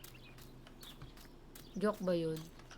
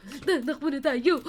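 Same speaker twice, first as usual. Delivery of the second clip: excited laughing shout; 3 semitones higher, 6 dB louder, slower than usual. Real, not acted. Fleeing in game, laughing.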